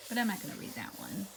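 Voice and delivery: usual speaking voice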